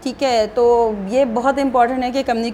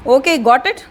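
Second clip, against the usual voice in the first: irritated, a bit louder, with a sharp rise at the end; angry; 4 dB louder, much slower than usual. Shown as a demonstration. Teacher showing interview students an annoyed tone, irritated. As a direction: snap it out as if they still haven't understood. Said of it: "बहुत गुस्से से बात कर रही हूँ".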